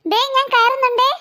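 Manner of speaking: usual speaking voice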